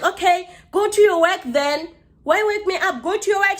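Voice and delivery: high, loud retold comeback; exasperated retort. Woken sleeper telling neighbour to leave, retold exasperation. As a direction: fire back in mock exasperation.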